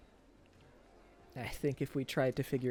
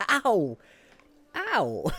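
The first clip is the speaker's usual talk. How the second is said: loud, playful yelps of pain, higher; mock pain yelp; 8 semitones higher, 11 dB louder than usual. Playfully hit by her, yelping loud mock pain. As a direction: yelp like she really hurt you. Listener hits him playfully.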